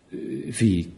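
a lover caught off guard, slow and soft; stammering. A narrator's reading. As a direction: stumble over the words. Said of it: "stammelte er".

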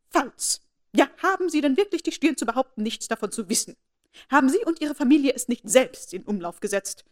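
haughty aunt accusing the Bennets; outraged. A narrator's reading. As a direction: throw the accusation at her. Lady Catherine outraged.

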